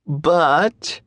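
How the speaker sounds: a long teasing "But"; drawn-out. A narrator's reading. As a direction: stretch the word slowly, high. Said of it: "she draws out the word".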